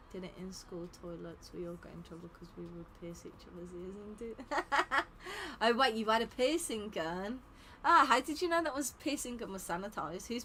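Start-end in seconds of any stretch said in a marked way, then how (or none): 4.4-5.5 high, real burst of laughter; sudden high giggle. Real, not acted. Reacts to chat story.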